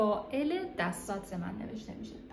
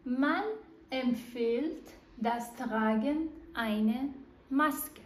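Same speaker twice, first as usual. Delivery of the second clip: slow dictation; much slower than usual. Shown as a demonstration. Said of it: "بایشپیل سوم".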